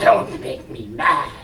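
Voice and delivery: low, slow, mock threat through laughter; hammed-up deep menacing voice. Shown as a demonstration. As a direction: play the tough guy, slow and low. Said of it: "If I talk like this".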